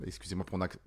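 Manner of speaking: ordinary delivery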